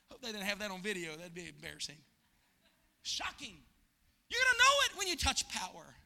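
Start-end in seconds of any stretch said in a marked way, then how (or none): none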